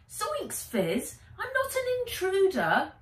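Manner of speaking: high, startled outburst; startled protest. Acted. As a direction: yelp it out in protest. Nat protests after being burned.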